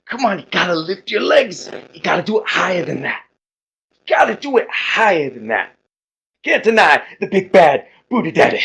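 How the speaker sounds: loud, high, bellowed wrestler promo; big showboating strongman voice; boastful muscle-man hype. Acted. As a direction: hype up the crowd like a pumped-up wrestler. Same character barks exercise orders.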